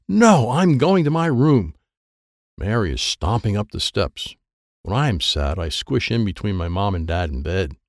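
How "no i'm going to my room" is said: louder, higher huffy protest; upset refusal. Acted. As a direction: storm off in a huff. Upset girl storms off.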